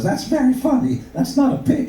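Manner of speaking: very high falsetto wife; wife's retort. Acted. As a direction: retort in a mock woman's falsetto. Voices wife replying in joke.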